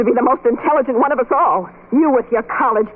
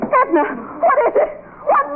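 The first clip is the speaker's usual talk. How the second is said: horrified scream. Acted. Friend bursting in on injured Edna, horrified screaming. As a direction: shriek her name in horror.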